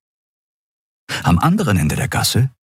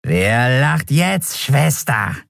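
slow, higher sneering voice; gloating skeleton; 5 semitones higher, much slower than usual. A narrator's reading. Skeleton mocks the captured Endriel, slow and sneering. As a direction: savor the taunt slowly.

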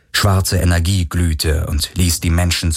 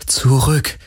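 trembling voice; 5 semitones higher than usual. A narrator's reading. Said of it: "Ihre Stimme bebte".

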